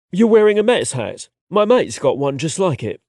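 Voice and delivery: London lad, matter-of-fact; London accent. A narrator's reading. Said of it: "his London accent".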